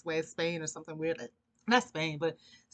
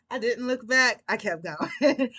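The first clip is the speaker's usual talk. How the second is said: emphatic declaration; 5 semitones higher, 9 dB louder, slower than usual. Real, not acted. Punchline retelling her quick exit.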